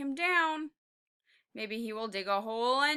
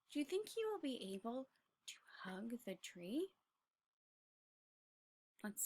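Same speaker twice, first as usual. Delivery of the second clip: breathy unvoiced whisper; 17 dB quieter than usual. Real, not acted. Teacher whispering a question to the children.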